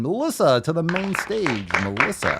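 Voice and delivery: hint of a club announcer; lightly put-on announcer voice; mock stage announcer. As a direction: call her to the stage, playfully. Mock announcer calls someone to stage.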